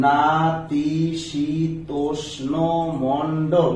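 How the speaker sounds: নাতিশীতোষ্ণ spoken very slowly, then মণ্ডল; teacher sounding out নাতিশীতোষ্ণ মণ্ডল; slow syllable demo. Shown as a demonstration. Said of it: "আমার উচ্চারণে আসে". (ধীর উচ্চারণে দেখানো)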